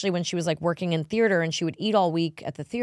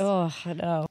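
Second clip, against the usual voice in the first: ordinary talk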